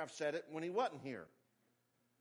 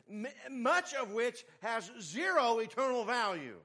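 higher, punched sermon delivery; emphatic preaching; 7 semitones higher, 5 dB louder than usual. Real, not acted. Preacher warning parents about what they push on children, emphatic. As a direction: drive each phrase home to the congregation.

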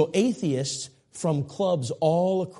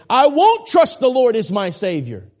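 much higher and louder, stressed won't; mock defiant refusal; 11 semitones higher, 11 dB louder than usual. Preacher voicing a defiant listener refusing the Savior, loud mock shout. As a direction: shout the refusal as the stubborn sinner would. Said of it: "anyone here this morning that would say".

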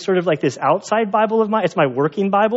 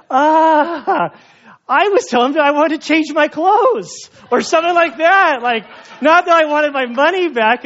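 much higher, louder put-on voice of the Spanish cashier; amused cashier; 10 semitones higher, 4 dB louder than usual. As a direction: voice the laughing Spaniard explaining. Spaniard explains the misunderstanding.

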